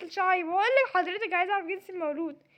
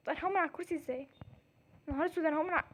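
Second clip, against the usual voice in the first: quick, softer worry to oneself; worried aside; 3 semitones lower, 7 dB quieter, much faster than usual. Acted. New doctor wonders about leaving the chair, quick soft worry. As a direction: say it fast and low-key. Realises she cannot get up.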